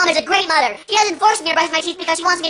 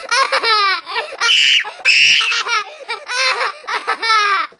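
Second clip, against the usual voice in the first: woozy crying laugh; 6 semitones higher than usual. Acted. Groggy laugh after dentist.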